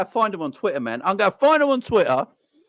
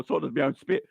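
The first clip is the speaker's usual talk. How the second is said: ordinary talk, nothing marked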